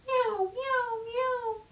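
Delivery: high mewing cat sounds; cat meows. Acted. Catbird showing the rabbit, high mewing. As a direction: mew like a crying cat. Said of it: "that bird went".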